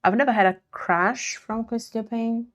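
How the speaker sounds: normal talking voice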